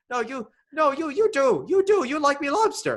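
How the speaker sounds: very high, pleading hammed-up insistence; insistent old seadog. Old keeper insisting his lobster is liked, mock-desperate protest.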